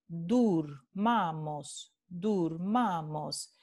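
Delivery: durmamos drawn out slowly, each syllable clear; slow repeat. Shown as a demonstration. Teacher repeating durmamos slowly for the class. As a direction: spell out durmamos slowly, dur-ma-mos. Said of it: "De nuevo".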